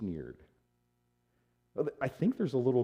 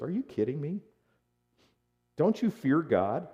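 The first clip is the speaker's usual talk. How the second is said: higher, rising disbelief; incredulous rebuke; 6 semitones higher than usual. Acted. One felon rebukes the other, incredulous. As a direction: challenge him with rising questions. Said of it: "the other criminal rebuked him".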